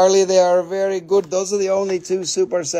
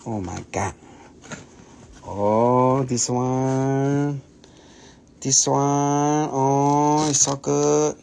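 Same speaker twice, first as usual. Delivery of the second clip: choked-up awe; 4 semitones lower, much slower than usual. Real, not acted. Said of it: "one for crying".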